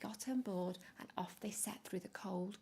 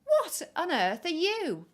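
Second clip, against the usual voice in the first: slow, loud, higher astonished bear voice; puzzled polar bear; 9 semitones higher, 11 dB louder than usual. Acted. Polar bears staring at the penguin, slow and astonished. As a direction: gape at the strange bird.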